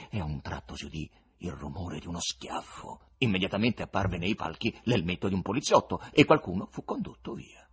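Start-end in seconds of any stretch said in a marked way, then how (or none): none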